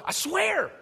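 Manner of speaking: exaggerated pleading I swear; mock earnest oath. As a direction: swear it like you mean it, mockingly.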